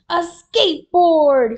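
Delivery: high, very loud exclamation; thrilled yell, stretched out; excited shout. A narrator's reading. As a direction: shout with delight at a present. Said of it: "he shouted".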